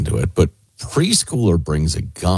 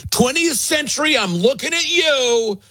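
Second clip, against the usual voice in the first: high-pitched mock scolding; founding father role. Founding fathers warning posterity, high mock scolding. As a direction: call out a later generation in a raised, put-on voice. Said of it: "the founding papas understood when they said".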